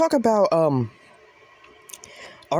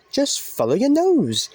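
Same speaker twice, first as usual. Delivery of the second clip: much higher, slow mock cartoon mascot voice; sing-song imitation of a cereal ad mascot; cereal commercial mascot; 11 semitones higher, slower than usual. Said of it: "those commercials".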